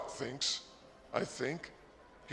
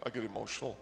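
usual speaking voice

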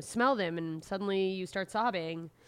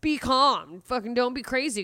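mock stern self-command; 6 semitones higher, 5 dB louder than usual. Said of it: "the rest of you is like".

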